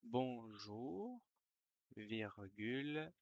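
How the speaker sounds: ordinary talk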